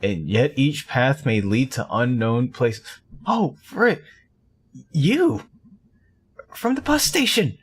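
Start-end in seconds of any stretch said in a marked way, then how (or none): 3.3-7.6 high, surprised outburst; startled recognition. Acted. Witch spotting the man from the bus station, startled and high. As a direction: jump up in pitch, caught off guard.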